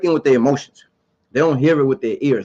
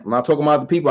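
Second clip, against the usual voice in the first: ordinary talk, nothing marked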